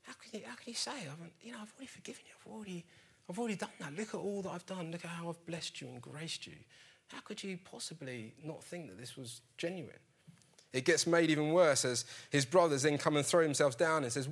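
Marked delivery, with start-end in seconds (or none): 0.0-10.0 much higher and softer, pained disbelief; a wounded brother pleading, voice pitched up and hushed; distraught Joseph. Retold or imitated. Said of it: "He's like".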